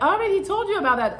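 quick, high defensive snap. Retold or imitated. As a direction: brush him off fast and high, imitating her. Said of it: "She gets defensive".

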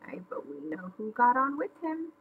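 quick, soft knowing aside; hushed aside. Acted. Narrator confides in listeners about stowaways, hushed aside. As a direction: drop to a quick confidential murmur.

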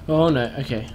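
usual speaking voice

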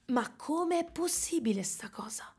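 unvoiced whisper of disbelief. Real, not acted. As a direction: breathe it out with no voice. Complaining about losing her voice. (sussurrato incredulo)

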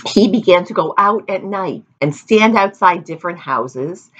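usual speaking voice

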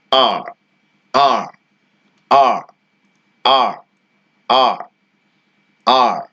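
আর with a light, barely rolled র; soft r, slowly. Shown as a demonstration. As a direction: drawl আর gently, r softened. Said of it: "র এর উচ্চারণটা একটু কম করতে হবে". (র কম করে, ধীরে আর)

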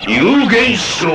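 slow, high battle cry of the move name; technique call. Acted. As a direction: proclaim the secret technique slowly. Announcing a sword technique.

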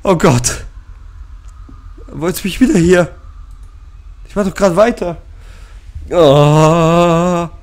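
high, loud frightened cries; panicked shrieking. Real, not acted. Gamer fleeing a ghost, shrieking in panic.

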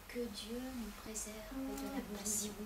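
soft murmured prayer said again; the boy repeats his prayer very softly; hushed prayer repeated. Acted. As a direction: keep the prayer low and hushed. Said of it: "comme à son habitude".

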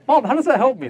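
put-on high, whiny child's protest; indignant child. Hurt child complaining to mom, high and whiny. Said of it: "The child's like".

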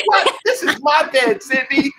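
playfully scolding a chihuahua that growled; mock indignant. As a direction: squeak it at the dog in pretend outrage. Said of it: "I was like".

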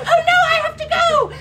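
very high, loud, laughing; panicked cry. Acted. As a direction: squeal in a hammed-up panic. Midnight strikes.

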